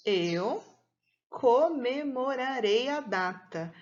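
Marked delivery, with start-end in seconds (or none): 1.3-2.9 slow "comemorarei", each syllable stretched; drawn-out model answer. Shown as a demonstration. Teacher modelling the future tense for pupils, slow and deliberate.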